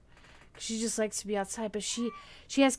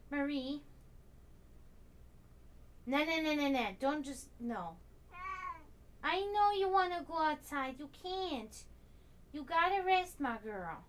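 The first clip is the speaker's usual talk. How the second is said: soft scolding coo; 5 semitones higher, 8 dB quieter than usual. Real, not acted. Owner stopping her sick cat from going out, soft high pleading. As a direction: gently tell her no.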